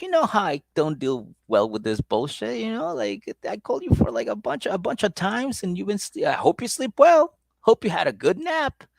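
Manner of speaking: a hint of a scolding girlfriend; mock angry girlfriend. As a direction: nag him in a high voice. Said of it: "she got mad at him".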